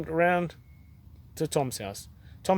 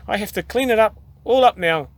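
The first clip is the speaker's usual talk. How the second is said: moaned; 5 semitones higher, 5 dB louder than usual. A narrator's reading. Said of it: "he moaned".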